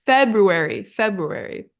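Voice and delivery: FEBRUARY as FEB-roo-airy; subtle R sound. Shown as a demonstration. Teacher models the subtle R, FEBRUARY as FEB-roo-airy. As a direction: slip a light R after the B in FEBRUARY. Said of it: "very subtle R sound".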